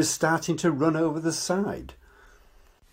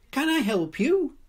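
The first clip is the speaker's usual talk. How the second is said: small clear bear voice; 13 semitones higher than usual. Acted.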